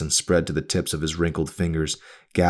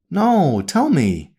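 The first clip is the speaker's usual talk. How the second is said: higher, prying mother questioning her daughter; a nagging mother, pitched up and pressing; mother's voice; 11 semitones higher, 4 dB louder, much slower than usual. A narrator's reading.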